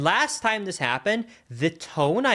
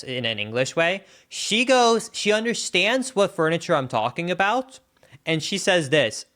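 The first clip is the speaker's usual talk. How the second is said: ordinary talk, nothing marked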